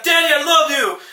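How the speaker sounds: put-on high, laughing little-girl cry; lightly voiced eight-year-old daughter; mock child squeal. Little daughter runs into ring.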